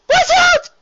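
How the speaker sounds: very high shrieking warning; alarmed shout. Acted. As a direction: scream the warning. Rarity warning in danger.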